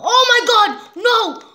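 high, loud panicked cry; frantic shriek of alarm; panicked yell. Real, not acted. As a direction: scream it as you nearly die. Near death in game.

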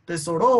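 tesoRO, wrongly stressed on a loud RO; stress on last syllable. Shown as a demonstration. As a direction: shove the weight onto the final RO of "Tesoro". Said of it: "es esa sílaba que suena más fuerte". (Tesoro con golpe fuerte en RO)

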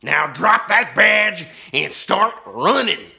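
loud, very slow threatening drawl; menacing outlaw. A narrator's reading. Ten Gun Ben threatens Laura's dad, slow and loud. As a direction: draw out the threat.

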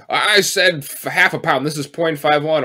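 loud mock fussy customer; petty complaint. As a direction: complain about the weight pettily.

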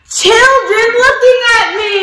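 high, bright storybook payoff; delighted reveal. Acted. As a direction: reveal it like a happy surprise.

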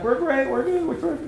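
high, eager crowd voices; cheerful crowd reply. Retold or imitated. Audience answering the hypnotist, high and eager. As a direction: chirp it back like a happy audience.

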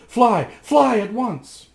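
urgent cry. Acted. Giulietta cries to Rasmus to flee at once. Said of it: "cried Giulietta".

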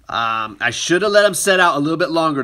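ordinary talk, nothing marked

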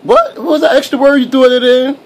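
very high, incredulous play-scolding; mock outrage. Adult challenging a baby's extra word, mock outraged. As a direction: shoot the pitch up in mock disbelief. Mock argument with babbling baby.

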